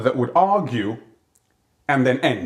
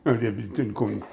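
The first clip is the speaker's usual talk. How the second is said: imitating a father's private grumbling; dad talking to himself; 3 semitones lower, 4 dB quieter than usual. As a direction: mumble to the wall like a put-out dad. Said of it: "talk to the wall or talk to himself".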